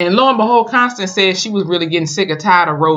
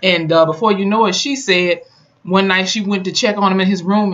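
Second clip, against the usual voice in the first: normal talking voice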